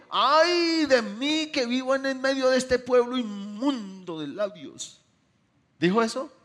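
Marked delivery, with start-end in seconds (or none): 0.1-5.0 slow, loud, higher lament, hammed up; mock wrong version of the prophet. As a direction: play the misquoted prophet grandly. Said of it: "Él no dijo".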